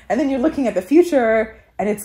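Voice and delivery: usual speaking voice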